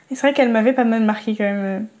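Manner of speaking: ordinary talk, nothing marked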